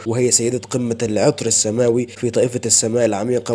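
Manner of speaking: ordinary delivery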